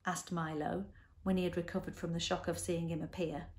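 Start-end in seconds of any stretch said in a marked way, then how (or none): none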